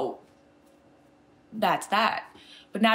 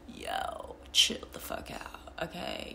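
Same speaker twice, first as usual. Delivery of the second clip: unimpressed retort; 6 dB quieter, slower than usual. Acted. Her acted retort to the mockers.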